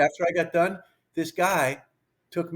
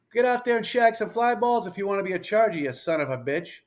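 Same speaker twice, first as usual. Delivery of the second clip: yelling; 7 semitones higher than usual. Retold or imitated. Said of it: "he's yelling at all the players".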